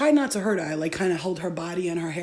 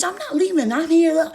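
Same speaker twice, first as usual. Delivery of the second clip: much higher, louder shout of refusal; shrill defiant yell; 9 semitones higher, 8 dB louder than usual. Retold or imitated. Girl refusing to leave, now screaming. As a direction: scream that she's staying. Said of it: "And she's like".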